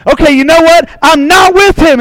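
retold yell. Peter yelling at the third accuser, acted anger. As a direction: snap back at the accuser in a mock rage. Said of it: "he's yelling at this guy".

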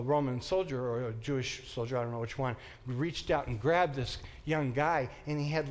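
normal talking voice

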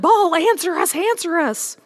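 high, loud desperate cry to an idol; shouting. Acted. Prophets of Baal cry out to their god, high loud shout. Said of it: "And they shouted".

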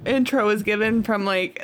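ordinary talk

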